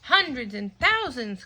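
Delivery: crying out. Acted. Peppa exclaiming at pouring batteries, excited. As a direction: call it out in delight. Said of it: "cries Peppa".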